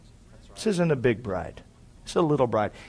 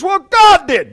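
cousin's reply; 13 dB louder, slower than usual. Retold or imitated. Cousin voiced in a quarrel.

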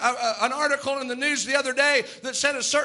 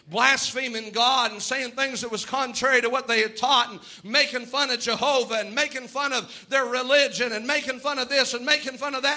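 usual speaking voice

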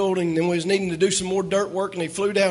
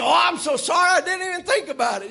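a hint of a higher, sheepish apology; mock apology; 8 semitones higher than usual. Evangelist apologizing to the pastor, lightly mock sheepish. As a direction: lightly act the friend's sorry. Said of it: "He said".

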